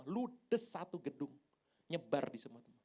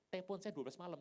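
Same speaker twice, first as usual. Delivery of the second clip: normal talking voice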